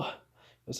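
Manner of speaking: usual speaking voice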